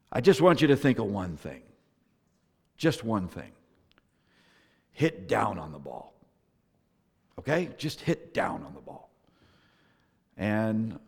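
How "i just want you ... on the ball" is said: boil it down patiently, one thing at a time. Retold or imitated. Grandfather's one simple tip.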